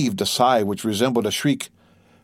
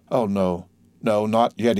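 ordinary delivery

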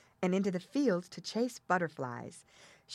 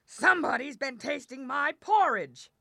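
big, growling bear voice, pitched up; 8 semitones higher, 3 dB louder than usual. A narrator's reading. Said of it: "he growled out".

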